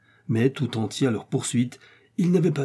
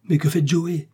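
higher, urgent question; alarmed exclamation; 6 semitones higher than usual. A narrator's reading. Kennedy watching Joey ride on, alarmed exclamation. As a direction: cry it out, mildly alarmed. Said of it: "s'écria Kennedy".

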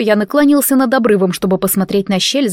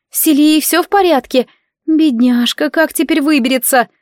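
higher, worried motherly voice; fussy mother; 6 semitones higher than usual. Acted. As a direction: fuss like an anxious mama.

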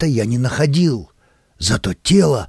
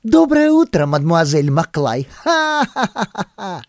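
merry laughing greeting; 5 semitones higher than usual. A narrator's reading. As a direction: call out hello, then laugh. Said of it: "услышал весёлое".

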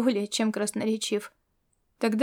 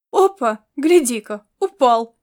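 excited; 7 dB louder than usual. A narrator's reading. Watching the thief fall.

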